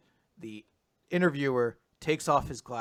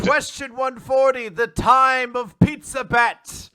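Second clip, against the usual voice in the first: slow, high and loud hype voice; epic announcer; 11 semitones higher, 10 dB louder, slower than usual. Pretend. Hosts hyping the big exam episode, booming and slow. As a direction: announce it like a grand event.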